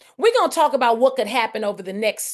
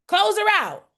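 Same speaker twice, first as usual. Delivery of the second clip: urging command; 10 semitones higher than usual. Real, not acted. Coach repeats the command, higher.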